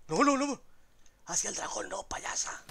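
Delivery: being chased. Real, not acted. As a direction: yell in panic as the dragon closes in. Dragon attacks, goat fleeing.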